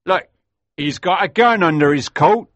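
high, loud call of discovery; old smuggler alerting the gang. A narrator's reading. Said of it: "said an old smuggler".

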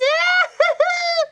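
very high, loud cackling laugh; kookaburra cackle. A narrator's reading. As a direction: cackle away like a bird. Kookaburra laughs after its jibe.

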